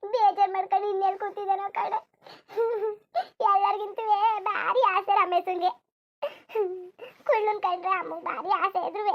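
high, drawn-out grieving lament; distraught mourning woman. Acted. Mourner begging them to phone the absent Ramesh, distraught. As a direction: plead urgently through the wailing.

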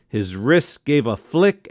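ordinary delivery, nothing marked

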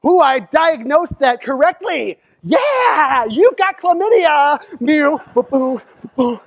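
high, slow gloating celebration; celebrating doctor. Retold or imitated. A doctor celebrating a diagnosis, high and gloating. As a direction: strut it like a touchdown. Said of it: "you don't see doctors".

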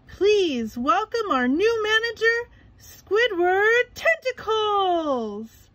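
high, loud, slow showman call; big announcement. A narrator's reading. Mister Krabs to the crowd, high loud announcer call. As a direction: boom out the name. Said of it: "he'd announced".

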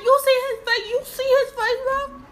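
high-pitched, loud disbelief; incredulous outburst. Real, not acted. Reactor points out a face in the video, incredulous. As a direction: cry out in amused protest.

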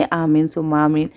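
ordinary talk, nothing marked

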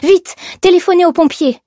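high, loud cry for help; urgent shout. A narrator's reading. Employee begging boss to call firemen, urgent shout. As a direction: call out urgently, pitched right up.

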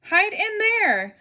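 high, slow urgent order; pulling brothers inside, high and drawn out; urgent cry. Acted. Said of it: "cried the third little pig".

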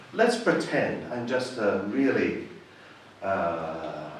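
ordinary talk

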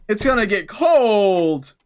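put-on slow pleading child voice; slow whining over a cold pancake; whiny kid. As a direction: drag it out like a begging kid. Child character begs for pancakes.

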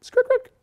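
high chirping crick, crick; silly cricket sound; cricket chirps. Retold or imitated. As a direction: imitate crickets in the silence.

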